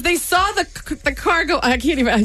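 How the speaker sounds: tripping and restarting on the words; real stutter. Real, not acted. Telling how onlookers laughed at her, stuttering. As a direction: stumble over the words mid-story. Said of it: "you hear me stutter?".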